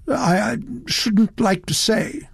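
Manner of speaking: high, slow, reluctant gentleman; hesitant evasion. A narrator's reading. Satterthwaite dodging Elizabeth's question, hesitant. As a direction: stammer and dodge politely.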